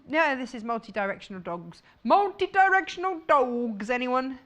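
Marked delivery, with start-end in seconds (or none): 2.0-4.4 playful hawking. Pretend. Host jokingly offering dog fabric to viewers, playful hawking. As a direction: call it out as a silly offer.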